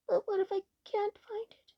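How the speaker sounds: high, hushed, scared little voice; frightened. A narrator's reading. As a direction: ask fearfully in a high half-whisper. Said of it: "cried the frightened elf".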